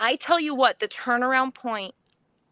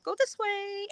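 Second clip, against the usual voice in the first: directing call; 9 semitones higher, 4 dB quieter than usual. Retold or imitated. Somebody directing runners on the course, light high call. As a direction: imitate a course marshal calling high.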